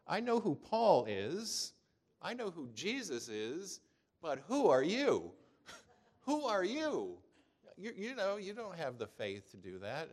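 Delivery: high, loud, slow taunting demon voice with a laugh; mocking demon. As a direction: sneer it like a demon laughing at the exorcists. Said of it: "And the demon says".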